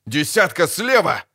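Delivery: high sharp shouted warning; barked warning. Acted. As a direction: bark the warning across the fight. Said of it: "рявкнул Ногорн".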